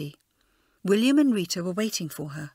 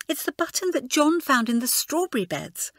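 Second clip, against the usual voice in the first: solemn; 7 semitones higher than usual. A narrator's reading. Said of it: "said Rosemary solemnly".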